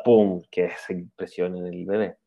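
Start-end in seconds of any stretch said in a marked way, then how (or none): none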